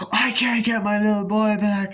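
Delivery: high, loud put-on wailing; mock anguished wail. Mother confronting him about her boy, mock anguished wail. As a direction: wail it in hammed-up grief.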